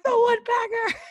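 high startled shout; yelling out. Retold or imitated. Telling mom on the phone, a high yelled alarm. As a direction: blurt it out in alarm.

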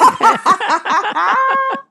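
high, loud burst of laughter; real loud laugh; big laugh. Real, not acted. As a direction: crack up at the joke. Guest's pizza joke.

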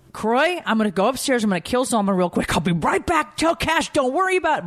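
high-pitched breezy fake menace; mock threat. Mom to husband about the nanny, high mock threat. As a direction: announce a joking threat in a high voice.

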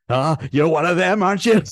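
high, loud fake American accent; bad American accent. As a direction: put on a clumsy American accent. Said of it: "Gruber's American accent".

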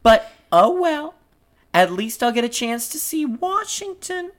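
high-pitched, self-important stage voice; piping character, airily resigned; Marie's character voice. Acted. Reads Marie's part in script.

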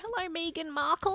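put-on a jokey Cockney accent, half laughing; mock Cockney. Greeting Meghan Markle in a joke, mock Cockney accent. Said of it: "Why do we have Cockney accents?".

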